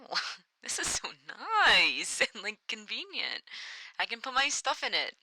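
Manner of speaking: lightly voiced delighted factory woman, breathy and giggly; delighted women. Vlogger imitating a woman happily using pockets. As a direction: play a woman thrilled with her pockets. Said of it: "being like".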